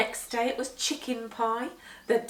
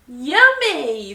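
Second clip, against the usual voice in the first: put-on delighted child; 4 semitones higher, 13 dB louder than usual. A narrator's reading. Jenny delighted.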